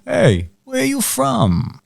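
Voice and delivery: lightly voiced slow, high-pitched stranger; imitating a stranger. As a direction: draw out a lilting street question. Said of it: "this guy stops me and he's like".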